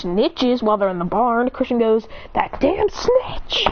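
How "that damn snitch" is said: high, drawn-out indignant outburst; mock outrage. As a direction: act exasperated, pitching it way up. Acted angry outburst at snitch.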